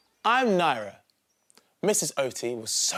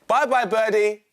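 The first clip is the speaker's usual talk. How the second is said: calling out; 5 semitones higher, 7 dB louder than usual. Acted. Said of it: "called Missus Oti".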